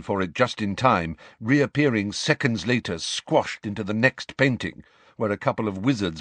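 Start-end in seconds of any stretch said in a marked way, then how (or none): none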